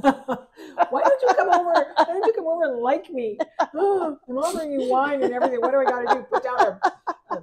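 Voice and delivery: high, loud pleading through laughter; hammed-up whiny plea, laughing; laughing mock plea. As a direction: beg her over, cracking up as you go.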